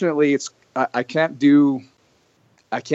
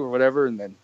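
normal talking voice

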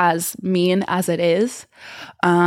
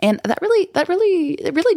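much higher emphatic repeat; squeaky emphatic really; pitch leap; 11 semitones higher than usual. Real, not acted. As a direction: jump way up on really. Emotional emphasis.

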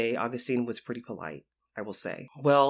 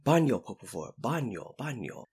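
deep voice. Retold or imitated. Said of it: "in a, like, deep voice".

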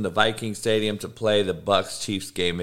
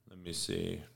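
low, much softer mutter under his breath; muttering to self; 7 semitones lower, 17 dB quieter than usual. Real, not acted. Host searching notes off-mic, quiet mutter. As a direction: drop out of the mic, talking to yourself. Muttering to himself mid-show.

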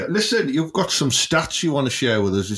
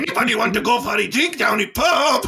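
mock Geordie; 10 semitones higher than usual. Mocking Geordie lads asking for a pint, high Geordie shout. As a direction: yell it like a Geordie lad down the pub. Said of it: "And then they go".

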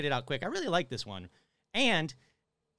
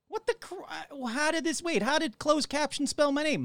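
surprised; 12 semitones higher than usual. Real, not acted. Caption misspelled his name.

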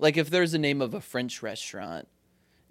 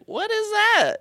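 mock disbelief; 3 dB louder than usual. Mock disbelief quoting self.

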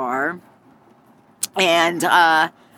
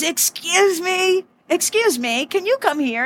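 icy summons; 11 semitones higher than usual. Retold or imitated. Owner summons the rude customer.

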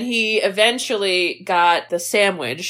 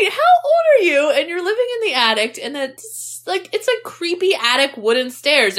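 high, fast disbelieving squeal; incredulous high voice; 5 semitones higher than usual. Retold or imitated. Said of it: "I was like".

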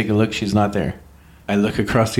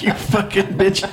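high, slow, laughing fake outrage; laughing mock insult; 10 semitones higher than usual. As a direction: pretend to be furious while cracking up. Mock insult at the flight attendant.

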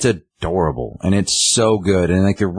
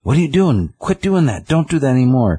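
mock old cat scolding, much higher; cat scolding a pest in a higher put-on voice; voicing the old cat; 7 semitones higher than usual. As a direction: play the grumpy old cat telling off the kitten. Old cat Sue scolding kitten.

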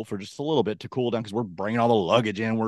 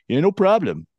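put-on northern accent; 8 semitones higher, 6 dB louder than usual. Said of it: "that was his accent".

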